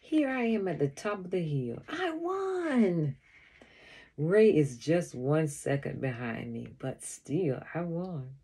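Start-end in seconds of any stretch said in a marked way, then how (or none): 1.9-3.1 high, drawn-out victory call; triumphant cry. A narrator's reading. Racer at the top of the hill, triumphant. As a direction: crow it out high, stretching the word. Racer reaches the hilltop first.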